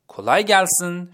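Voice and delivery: کلای گلسن said slowly and carefully; Turkish phrase demo. Shown as a demonstration.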